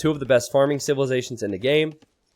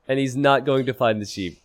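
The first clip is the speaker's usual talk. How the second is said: ordinary delivery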